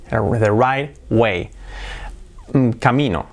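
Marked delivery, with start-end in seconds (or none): 0.1-1.5 slow model phrase. Shown as a demonstration. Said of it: "Choose the right way".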